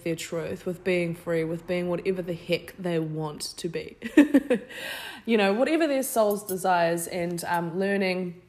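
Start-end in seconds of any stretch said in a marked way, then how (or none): 4.0-5.2 laughing. Real, not acted. Laughs at own phrase.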